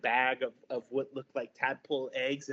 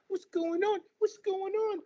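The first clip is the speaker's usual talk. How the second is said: very high squeaky hedgehog voices; tiny curious critters asking around; small creatures puzzled; little hedgehogs; 4 dB louder than usual. Acted.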